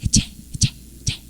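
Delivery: whispered, clipped attempts at "leche"; a small child trying out the tail of "leche" under the breath; toddler echoing. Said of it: "hablamos chistoso con ellos". (intentos susurrados de niño diciendo "leche")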